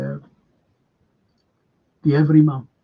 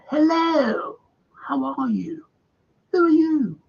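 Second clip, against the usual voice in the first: high-pitched mock snob voice; snobbish surgeon; 12 semitones higher, slower than usual. Snobbish surgeon greeting an elderly guest, mock-snooty and high.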